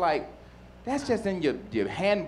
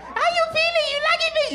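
woman's voice. Acted. Spanish woman calling to her lover, hammed-up falsetto. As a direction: squeal it in a put-on falsetto.

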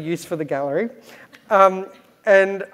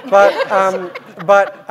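laughing mid-quote; 3 semitones higher, 9 dB louder, much slower than usual. Real, not acted. Laughs while quoting her boss.

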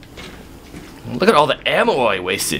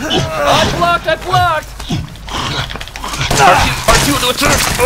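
panicked screaming. Real, not acted. Player blocking monster attacks, panicked screaming. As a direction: squeal in panic while fending off blows.

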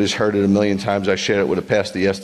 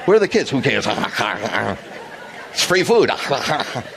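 mock greedy munching, raised; greedy eater; 5 semitones higher than usual. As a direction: ham up someone gobbling free food. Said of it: "you'd be like".

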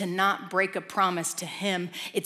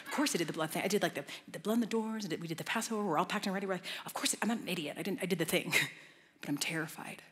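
nervous father; 2 semitones lower, 6 dB quieter, much faster than usual. Retold or imitated. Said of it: "the one's really nervous".

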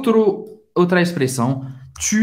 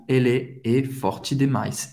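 slow example; 2 semitones lower, 3 dB quieter than usual. Shown as a demonstration. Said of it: "Você vai confirmar".

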